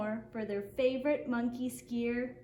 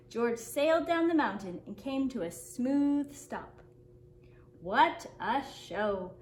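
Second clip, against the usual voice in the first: usual speaking voice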